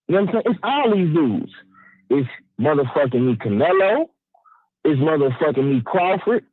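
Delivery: ordinary talk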